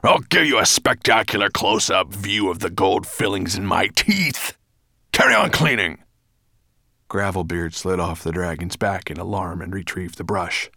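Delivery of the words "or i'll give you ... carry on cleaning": loud, menacing dragon order; angry, forceful growl; snapping threat. A narrator's reading. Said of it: "Nettlebrand snapped at him".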